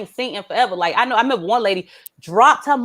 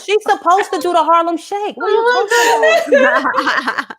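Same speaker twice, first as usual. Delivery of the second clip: sassy comeback; 7 semitones higher than usual. Retold or imitated.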